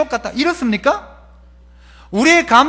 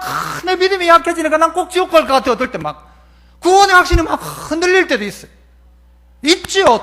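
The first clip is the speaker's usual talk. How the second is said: normal talking voice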